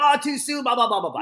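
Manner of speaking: high-pitched mock complaint, dissolving into blah-blah; mock offended crowd. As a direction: imitate an audience objecting. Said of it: "People are like".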